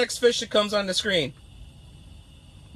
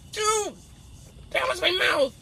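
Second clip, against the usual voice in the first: ordinary talk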